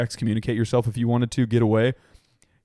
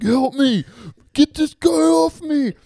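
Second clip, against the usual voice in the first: high, loud, slow panicked scream; panicked yell; 6 dB louder, slower than usual. Retold or imitated. Rhodes in a chokehold, screaming for help in a panic. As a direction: shriek for help like you're being choked. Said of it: "Rhodes starts panicking, and he's like".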